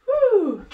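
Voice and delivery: a high, loud cheering whoop; excited whoop. Real, not acted. Fitness coach cheering her class through the transition, excited whoop. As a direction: let out a big whoop mid-workout.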